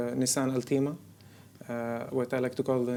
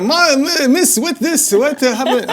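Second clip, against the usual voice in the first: put-on 1 kids; 12 semitones higher, 12 dB louder, slower than usual. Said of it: "They're always like".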